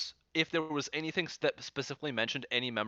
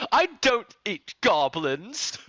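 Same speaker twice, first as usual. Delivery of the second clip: high, loud, drawn-out declaration; mock-indignant yell for comic effect; really loud; 8 semitones higher, 9 dB louder, much slower than usual. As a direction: announce it far too loudly, slowly. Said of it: "just said that really loudly".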